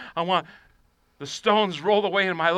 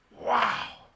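hushed, voiceless whisper; awed whisper; 3 semitones higher, 8 dB quieter than usual. Real, not acted. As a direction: breathe it out softly in wonder. Awed reaction to the scripture.

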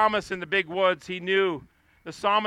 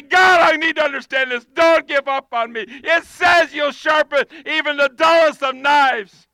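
crying out; 5 semitones higher, 6 dB louder than usual. Acted. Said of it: "And say".

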